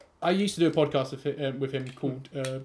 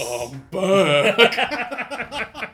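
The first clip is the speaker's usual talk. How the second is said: Macho Man voice; 5 semitones higher, 5 dB louder than usual. Pretend. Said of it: "Macho Man".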